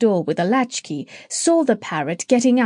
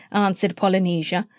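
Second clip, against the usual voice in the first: plain reading voice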